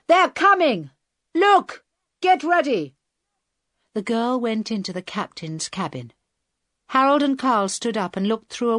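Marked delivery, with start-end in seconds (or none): 0.1-3.0 loud, high-pitched alarm; urgent shout. A narrator's reading. Bearded hijacker alerts his accomplice that men are coming, shouting. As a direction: shout a sudden warning to the gang. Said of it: "the bearded man shouted".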